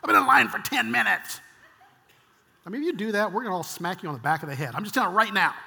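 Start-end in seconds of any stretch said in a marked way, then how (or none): 0.0-1.4 harsh, loud rasping rant; mock-furious parent berating a kid; worst parent. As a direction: growl it out loudly at the child. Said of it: "unless you're the worst parent".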